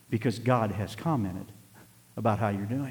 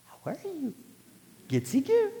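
put-on much higher, sing-song baby talk. Grandfather cooing at his grandchild, high baby talk. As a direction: coo at the newborn like a doting grandpa. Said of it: "he just melded down into a little".

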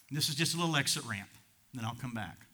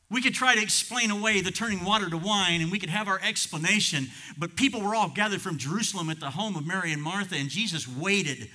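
higher, driving sermon delivery; forceful preaching; 5 semitones higher, 5 dB louder than usual. Real, not acted. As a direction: build the argument in punchy waves.

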